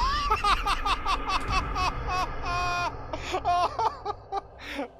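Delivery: long, high rattling laugh; hysterical laughter. Real, not acted. Player cracking up at the game, long hysterical laugh. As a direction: crack up and keep going.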